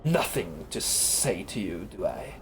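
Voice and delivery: low, slow menacing creature voice; slow low snarl from a reptilian demon; snarling fiend. Acted. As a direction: snarl it like a fiend looming over you. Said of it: "The creature snarls".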